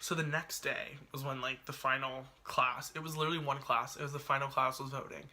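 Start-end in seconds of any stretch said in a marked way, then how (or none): none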